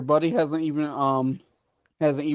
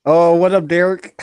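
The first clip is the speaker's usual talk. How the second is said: loud, cheerful hello; loud excited greeting; 9 dB louder than usual. Real, not acted. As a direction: call out the greeting with energy. Greeting a new viewer joining.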